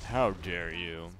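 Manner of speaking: mock outrage. Mock offense for Boston producer.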